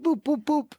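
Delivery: high, loud robot beeps. Retold or imitated. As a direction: imitate a droid's booping. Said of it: "he's like an R two D two kind of bot".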